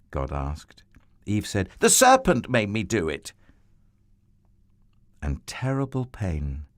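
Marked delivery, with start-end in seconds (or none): 1.8-3.3 louder, whiny blaming voice; voicing Eve blaming. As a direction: pass the blame like a child. Eve blames the serpent.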